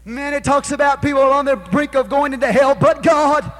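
high, loud, rising sermon cadence; preaching at full force. Acted. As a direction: preach it hard, each "but God" a hammer blow. Preacher building to climax.

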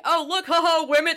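high, loud jeering put-on voice; mocking onlooker. Imitating spectators who laugh at the women, jeering. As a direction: sneer like the laughing crowd.

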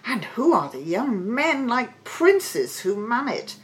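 high, wondering king; amazed cry. A narrator's reading. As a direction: marvel aloud in a high voice. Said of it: "he cried".

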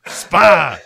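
loud, high accusing shout; sudden mock-confrontational bellow; demonstrated yell. Shown as a demonstration. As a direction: yell it right at him. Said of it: "Just yell it at him".

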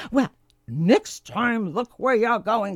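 a touch of grumpy impatience; lightly voiced grumpy creature telling him off; mild, grumpy scolding. A narrator's reading. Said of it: "spat the grumpy creature".

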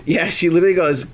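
normal talking voice

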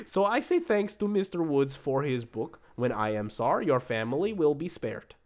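put-on Russian accent, grand and ominous. Reviewer thanking the book's author, Russian accent. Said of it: "I can do it in an accent".

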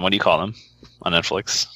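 normal talking voice

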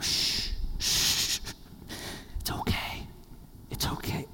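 hushed, whispered reassurance; soft shushing, then whispered comfort; soothing whisper. Acted. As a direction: whisper to settle a baby. Soothing a baby while bearing up.

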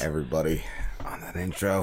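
whispered shout-out to everybody; hushed aside to the crew. Real, not acted. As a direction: breathe it, no voice at all. Host murmurs a shout-out.

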